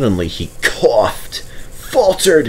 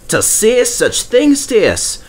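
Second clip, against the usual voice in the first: indignant outburst; 13 semitones higher, slower than usual. A narrator's reading. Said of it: "burst out Donald and Douglas".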